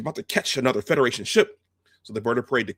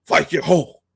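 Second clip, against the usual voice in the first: loud, higher-pitched commander's bark; barked Klingon order; 5 semitones higher, 7 dB louder than usual. Retold or imitated. Kroog storms into the bridge, barking.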